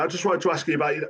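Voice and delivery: rattled off fast and careless; rushed mock reporter. Mocking the reporter who rang too soon, rushed and careless. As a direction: gabble it like a pushy journalist. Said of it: "He said it so meaninglessly fast".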